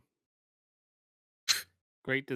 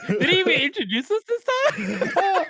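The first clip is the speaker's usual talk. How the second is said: laughing; 11 semitones higher, slower than usual. Real, not acted. Teasing the host.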